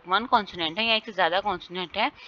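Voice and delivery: ordinary delivery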